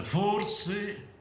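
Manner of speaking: normal talking voice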